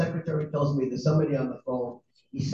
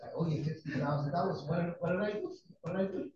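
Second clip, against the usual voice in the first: much softer, taken-aback questioning; meek bewilderment; 10 dB quieter than usual. Retold or imitated. Accused man answering angry caller, meek bewilderment. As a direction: shrink back from the accusation, quietly.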